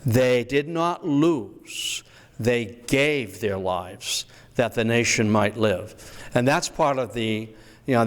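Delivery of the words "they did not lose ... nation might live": slow, leaning on "not" and "gave"; slow emphatic reading. Shown as a demonstration. Historian stressing "not" and "gave" for the audience, slow and solemn. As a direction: land hard on "not" and "gave", slowly. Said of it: "that's a different nuance".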